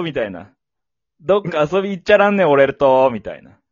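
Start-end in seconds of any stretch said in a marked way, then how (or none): 1.2-3.1 booming, hammed-up Hakata-dialect invitation. Fukuoka man asking a woman out, loud Hakata dialect. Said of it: "博多弁で行けや".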